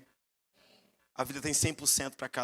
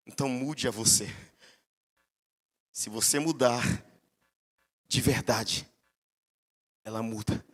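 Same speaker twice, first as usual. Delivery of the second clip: lightly voiced God; 6 semitones lower than usual. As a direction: speak gently as God answering. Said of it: "Ele falou bem assim". (voz de Deus, grave e calma)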